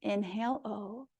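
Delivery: inhale, then a faint breathy "O"; soft breath demo. Shown as a demonstration. Yoga teacher leading Ujjayi breathing, soft breathy demo. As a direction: breathe the O in, barely voiced. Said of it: "take two of these here".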